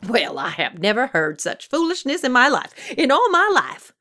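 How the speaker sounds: high, emphatic swoops of outrage; indignant scolding. A narrator's reading. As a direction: berate him, stressing word after word. Old woman scolds Death in disbelief.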